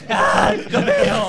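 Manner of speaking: high, slow, stretched-out shriek; outraged shriek. Acted. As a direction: squeal in disbelief. Angry nerd in the card scene.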